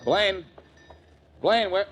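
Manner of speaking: a high, loud call across the shop; calling out for the missing pawnbroker. Acted. Blackie calls for Blaine through the store, calling out.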